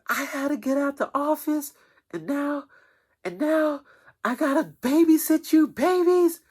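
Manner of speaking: put-on a high squeaky lady grumbling at the kids; imitating the office lady.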